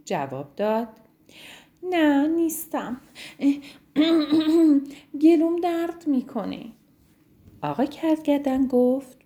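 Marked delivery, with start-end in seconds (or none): 1.8-6.7 higher, sad little giraffe voice with a throat clear; sore-throated giraffe. Acted. Zizi the giraffe answering the rhino, sad and sore-throated. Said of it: "ناراحت و گرفته بود".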